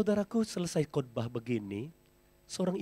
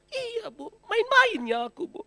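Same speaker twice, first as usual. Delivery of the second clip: woman excusing herself; 6 dB louder, slower than usual. Retold or imitated. Woman admits it was joking.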